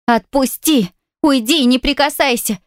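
high panicked shriek. A narrator's reading. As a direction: shriek to be let go. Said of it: "заверещала она".

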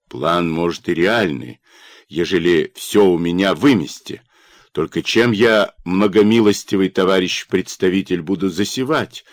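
ordinary delivery, nothing marked

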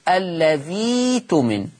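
الذي تمن with long ii, no glottal stop; slow recitation, dhii drawn out in الذي تمن; hamza replaced by ya. Shown as a demonstration. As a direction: stretch "alladhii-tumin" slowly. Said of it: "الياء، فتقرأ".

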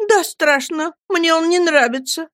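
put-on high, childlike sulky voice; girlish complaint. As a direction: pout it in a high young voice. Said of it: "промолвила Дора".

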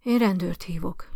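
ordinary delivery

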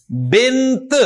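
بنت ending on a released t; final t sounded. Shown as a demonstration. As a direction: make the closing t of بنت heard. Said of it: "تو تا کی آواز آئے گی".

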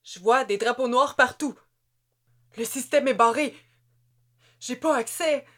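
put-on a young soldier losing control; panicked soldier. A narrator's reading.

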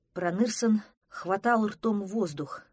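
plain reading voice

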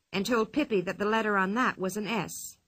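usual speaking voice

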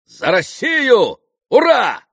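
high, slow, full-voiced cheer; proclaimed toast. A narrator's reading. Mitya toasting Russia again, proclaiming loudly. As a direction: proclaim it with a shouted hurrah. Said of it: "провозгласил он снова".

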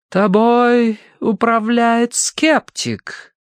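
high, very slow séance voice; intoning medium. A narrator's reading. Madame accuses a sceptic, slow high intoning. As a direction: stretch the words out eerily.

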